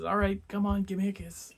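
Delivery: high, loud teasing come-on; mock sweet invitation. [name] teasing Absalom with a mock-sweet invitation. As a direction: sing out a sly fake offer.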